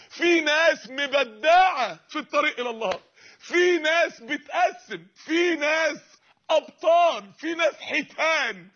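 high, loud, slow fervent call; fervent preaching. Real, not acted. Preacher extolling heroes on the path, fervent and loud. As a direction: build the crowd up with fire.

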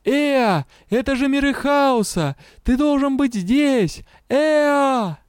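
high pleading cries; desperate calling. A narrator's reading. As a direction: call out in panic for help. Calling for help in the sea.

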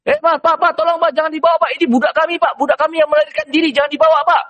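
high, pleading calls to the trader; pleading brothers. Acted. Brothers beg the caravan trader not to take him, high pleading. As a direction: beg the merchant, pitched high and urgent.

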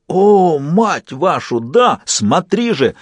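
high, drawn-out exclamations of triumph; exultant. A narrator's reading. Puppet to its host while taking over, exultant.

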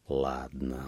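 low, soft reply; quiet assent. A narrator's reading. Peasant to the old man, quiet assent. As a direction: agree softly and low.

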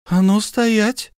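high, slow angry order; stamping halt. Acted. Wife halts her husband at the champagne, shrill angry. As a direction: stop him dead in his tracks. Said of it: "топнула ногой".

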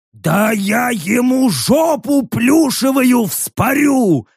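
high, slow, straining orc threat; hoarse growl. A narrator's reading. Orc Rek raises a knife at the bear, hoarse growl. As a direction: rasp it out slowly, straining high. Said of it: "захрипел Рек".

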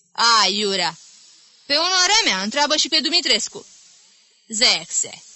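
high, loud, dismissive brush-off; scornful interjections. Acted. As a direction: wave the warning away with contempt. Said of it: "interjecțiuni disprețuitoare". (ton disprețuitor, ridicat și tare)

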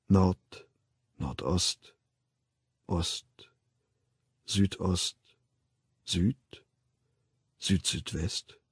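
low, soft, spaced-out compass points; slow hushed count. A narrator's reading. Narrator following the hanging feet turning, slow and hushed. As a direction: let each point fall quietly, with long pauses.

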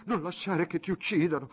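più acuta, urgente; Clelia concitata. Acted. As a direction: supplicalo con urgenza. Clelia urges him to fight. (Clelia esorta Fabrizio a difendersi, concitata)

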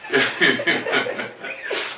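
a long laugh of disbelief; preacher laughing at a sister's excuse, amused. Real, not acted.

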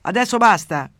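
loud megaphone command; shouted command. A narrator's reading. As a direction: thunder it like God through a megaphone. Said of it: "imitando la voce di Dio dentro un megafono, urlò".

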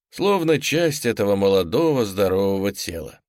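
usual speaking voice

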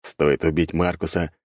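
ordinary delivery

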